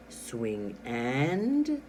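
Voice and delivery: AND stretched and left hanging before a pause; suspenseful pause. Shown as a demonstration. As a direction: draw out AND, then hold the suspense. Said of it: "how I paused after for the dot".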